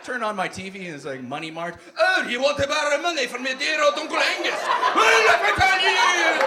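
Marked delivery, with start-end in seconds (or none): 2.0-6.4 loud, high Scottish ad pitchman; hammed-up Scottish ad shout; Scottish yelling. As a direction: yell the pitch like a Scottish uncle. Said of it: "Scottish guys yelling at me".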